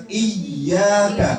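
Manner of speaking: Iya kan with y hit hard, iy-YAA-ka; stamped stress. Shown as a demonstration. Teacher drills mothers on the word, stamped stress. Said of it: "Iya-nya dihentak".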